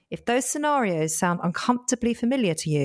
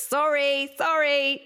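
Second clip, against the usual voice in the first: apologizing too much; 7 semitones higher, slower than usual. Acted. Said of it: "you've just apologised again".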